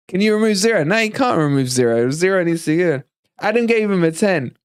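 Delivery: ordinary delivery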